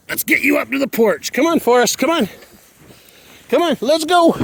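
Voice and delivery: very high pet-talk, climbing as it goes; high coaxing. Real, not acted. Owner luring Forrest up to the porch, very high coaxing voice.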